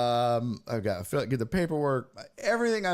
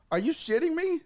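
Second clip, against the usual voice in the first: high-pitched mock disbelief; retold outraged disbelief; incredulous; 13 semitones higher than usual. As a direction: ask it way up high, exasperated. Retold reaction to clerk.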